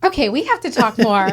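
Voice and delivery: thrilled at the coincidence; excited. Real, not acted. Host realizing they share a school, loud and fast with delight.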